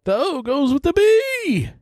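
very high-pitched, held-out squeal; Seinfeld impression. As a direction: push it way up high and stretch it out. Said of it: "That's my Seinfeld impression".

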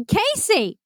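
high-pitched mock disbelief; incredulous correction. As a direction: set her straight, acting out baffled sergeants. Sergeants deliver the deflating correction.

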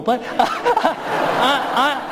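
laughing. Real, not acted. Laughs mid-reply to son.

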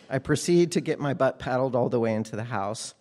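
ordinary delivery

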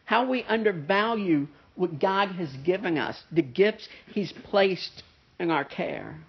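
ordinary delivery

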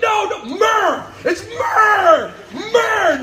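high, loud comic shout; yelling myrrh. Retold or imitated. Will Ferrell yelling "myrrh" at a lady in the street. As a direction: bellow "myrrh!" at her like a madman. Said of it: "yelling at this lady".

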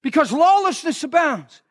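high, impassioned preaching voice; fiery preaching. Acted. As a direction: preach it out to the church at full force. Preaching at full force.

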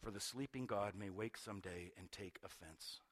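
soft, low intense reading; hushed reading. Acted. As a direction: draw the congregation in close. Quoting Annie Dillard, hushed.

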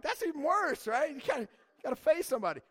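high, loud mock protest; mock outrage. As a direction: protest it like you've been wronged, half joking. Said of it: "I'm like".

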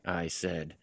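ordinary delivery

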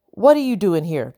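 ordinary delivery

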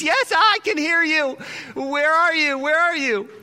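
high, loud frantic calling; panicked man shouting upward; dangling hiker calling out to the voice; frightened. Acted. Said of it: "He says".

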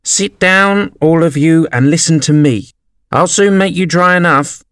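high, commanding little mouse voice; a pompous mouse calling the group to order; authoritative mouse calling out. A narrator's reading. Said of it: "called out".

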